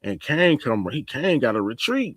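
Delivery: ordinary talk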